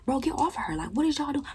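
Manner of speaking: high, urgent brother voice; brother breaking it up. Retold or imitated. Brother stopping family holding her down, high and urgent. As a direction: call them off, pitched high. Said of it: "He's like".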